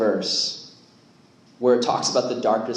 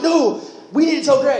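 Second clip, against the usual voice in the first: high, loud insistence; insistent shout; 12 semitones higher, 6 dB louder than usual. Retold or imitated. Leader retells insisting to a camp worker that Greg must be told, loud. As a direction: plant your feet and insist.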